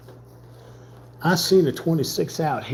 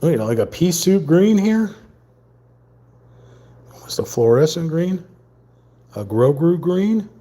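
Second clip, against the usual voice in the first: excited; 6 semitones higher, 5 dB louder than usual. Acted. Describing the alien green to viewers, high and excited. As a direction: gush about the strange green.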